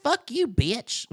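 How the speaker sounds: high, slow cartoon kid talking tough; Turkey Feather. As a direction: curse in a squeaky imitation voice. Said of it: "looking and sounding like Bobby Hill".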